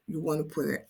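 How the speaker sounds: normal talking voice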